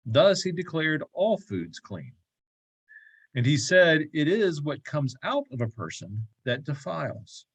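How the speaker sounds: measured, formal reading of a gospel passage; lectern-style Bible reading; scripture reading. Acted. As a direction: read the Bible text aloud to the group. Reads a gospel passage aloud.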